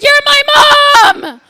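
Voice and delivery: high, loud anguished wail; wailed protest. Retold or imitated. Child wailing at her mom's cruel joke, loud anguished wail. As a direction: cry it out like a wounded five-year-old.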